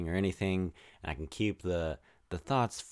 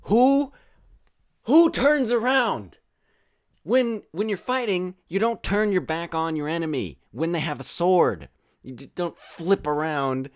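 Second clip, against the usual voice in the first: high, loud, incredulous rant; exasperated outburst; 9 semitones higher, 7 dB louder than usual. Real, not acted. Podcaster ranting at film fighters who turn their backs, exasperated. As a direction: wail it in disbelief, easing slightly by the end.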